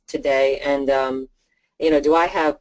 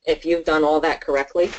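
usual speaking voice

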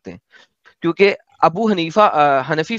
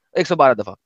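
ordinary talk

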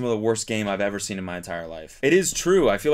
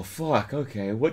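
normal talking voice

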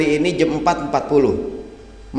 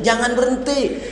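high mock cry, no real volume; hammed-up wifely protest, run together; mock yell; 8 semitones higher than usual. Wife telling the ustadz about her husband, high mock yell. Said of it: "saya teriak aja".

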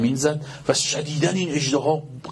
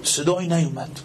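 usual speaking voice

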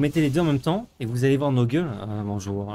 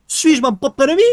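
higher and louder mock tragedian; grandiose theatrical; 11 semitones higher, 9 dB louder than usual. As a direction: declaim it like a stage hero. Repeated grander. (tragédien grandiloquent)

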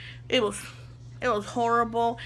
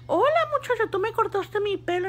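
much higher hola, muchacha; teasing customer; 10 semitones higher, faster than usual. Retold or imitated. Customer meeting the stylist again, teasing and high-pitched. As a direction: play him teasing her.

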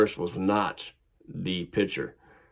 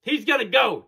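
much higher, louder insistent umpire voice; urgent umpire; 14 semitones higher, 3 dB louder than usual. Retold or imitated. Umpire demanding the coach remove a pitcher, high urgent voice. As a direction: press the coach to pull a player. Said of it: "The umpire comes over to our dugout and says".

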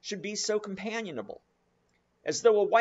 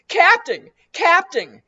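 much higher, much louder panicked shouting; frantic yell; 14 semitones higher, 14 dB louder than usual. Acted. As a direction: yell for the captain in terror. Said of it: "yelled the bumpkin".

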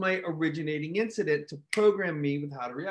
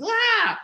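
sudden high yelp, louder; startled shriek; 7 dB louder than usual. Retold or imitated. Seeing a roach today, a high startled yelp. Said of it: "my first reaction is".

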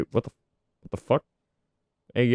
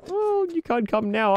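sassy high voice; 12 semitones higher than usual. Pretend. Guard voice continues.

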